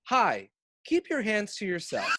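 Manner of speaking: mock training video. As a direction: sum it up as a chirpy mock instructional video. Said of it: "brief synopsis of what all of those videos".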